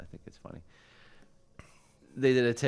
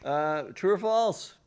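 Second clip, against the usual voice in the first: game show host; 9 semitones higher, 6 dB louder, slower than usual. Acted. Quiz host calls out question.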